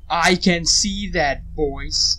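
slow, loud, pointed comeback; sarcastic retort. Real, not acted. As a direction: lean on it, annoyed at the obvious. Retort to chat warnings.

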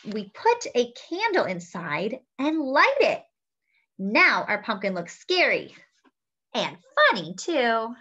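slow, clear storybook reading; reading to children. A narrator's reading. Host reading a picture book to children, bright and measured. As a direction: read it warmly to little listeners.

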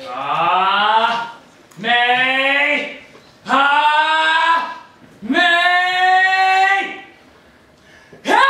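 power up scream. Pretend. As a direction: charge up the blast into a scream. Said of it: "hear your Kamehameha".

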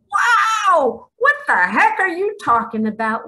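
loud, high astonished shout. Real, not acted. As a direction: burst out in shock. Reacting to God's words.

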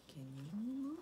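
ordinary delivery, nothing marked

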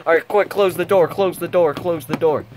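loud and frantic, high pitched; urgent shouting. Acted. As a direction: yell the order in a panic. Urgent order to close door.

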